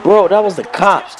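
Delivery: friend explaining. Retold or imitated. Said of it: "He was like".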